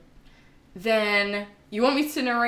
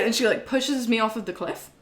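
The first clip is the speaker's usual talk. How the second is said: normal talking voice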